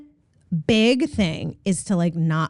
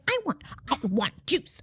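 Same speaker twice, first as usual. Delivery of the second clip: a light imitation of a toddler demanding juice; rude toddler demand; 4 semitones higher, 8 dB quieter than usual. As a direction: play the bossy little girl asking rudely. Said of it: "Can you do it for me?".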